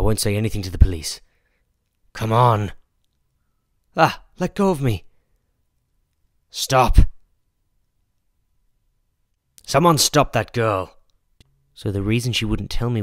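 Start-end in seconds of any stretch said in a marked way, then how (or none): 2.1-2.7 loud, tugging come on; straining call. Acted. Shop owner grappling with the girl, loud strain. Struggling with the thief. 4.0-5.0 high, loud, frightened yelp; panicked cry. Acted. Girl caught by the shop owner, high panicked cry. 6.5-10.9 loud call after a runaway; shouting after thief. Acted. Shop owner calling after the fleeing girl, loud shout.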